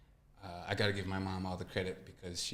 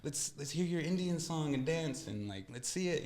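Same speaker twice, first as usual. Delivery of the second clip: mocking kids; 8 semitones higher than usual. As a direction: imitate a clueless kid asking for a show. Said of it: "people would look at me and be like".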